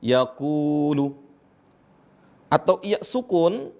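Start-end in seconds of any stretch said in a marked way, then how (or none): 0.0-1.2 "yaqulu" with a slowly held long u. Shown as a demonstration. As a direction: draw out the middle u in "yaqulu". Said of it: "sebelumnya ada dhammah". ("yaqulu" dengan u dibaca panjang)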